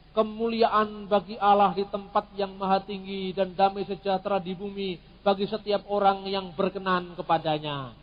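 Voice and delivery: ordinary talk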